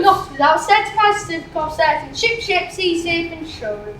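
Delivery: rattled off at speed; fast tongue twister. Acted. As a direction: gabble it quickly.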